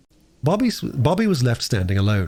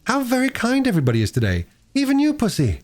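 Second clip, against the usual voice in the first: put-on much higher, quick, delighted girlish voice; girl cooing to a cat; 13 semitones higher than usual. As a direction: coo sweetly at a purring cat. Bobby coos to the station cat.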